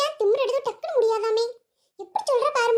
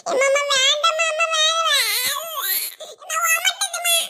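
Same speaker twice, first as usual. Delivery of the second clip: whining refusal; 5 semitones higher than usual. Acted. Girl begging mother, gagging.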